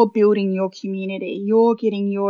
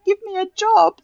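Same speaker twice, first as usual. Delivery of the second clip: cheeky ask; 11 semitones higher than usual. Retold or imitated. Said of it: "I turn around and I say".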